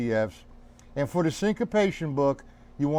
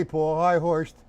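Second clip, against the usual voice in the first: usual speaking voice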